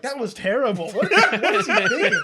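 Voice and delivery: cracking up while imitating the friends; mocking through laughter.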